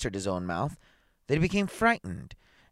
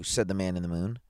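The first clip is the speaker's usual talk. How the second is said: ordinary delivery, nothing marked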